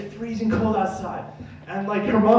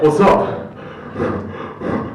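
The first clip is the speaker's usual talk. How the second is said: tough guy; 2 semitones lower, 3 dB louder than usual. Retold or imitated. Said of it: "walking like the WWF Bushwhackers".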